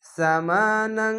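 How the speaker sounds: সামা নাং chanted slowly, নাং ending in a held nasal ng. Shown as a demonstration. Teacher showing students the nasal ending, slow chant. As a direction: end নাং with a ringing nasal hum. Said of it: "ওয়ান স্কারের মতো পড়লাম".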